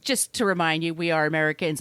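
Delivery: ordinary talk, nothing marked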